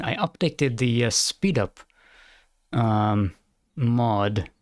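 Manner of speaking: usual speaking voice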